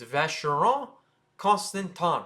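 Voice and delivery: Vacheron Constantin as vash-er-on con-stan-tan; French pronunciation. Shown as a demonstration. Teacher corrects the name, French pronunciation. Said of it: "That's not how you say it".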